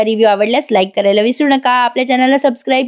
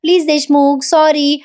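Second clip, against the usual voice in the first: coaxing plea, climbing in pitch; pleading apology; 4 semitones higher than usual. Retold or imitated. Wife saying sorry to sulking husband, pleading apology. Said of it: "कान धरते आणि म्हणते".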